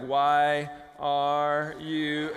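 very slow, word by word; reading giant text. Retold or imitated. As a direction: drag out each word as if scrolling huge letters. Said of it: "it was so big that when you looked at it on your phone".

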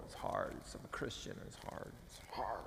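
whispered mock lament. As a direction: breathe it out, pretend misery. Mock complaining legalist.

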